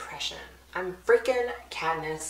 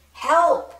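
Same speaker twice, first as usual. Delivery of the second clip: high, loud cry for help; panicked scream; 9 semitones higher, 10 dB louder than usual. Acted. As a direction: shriek it in terror. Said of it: "Prim is panicking".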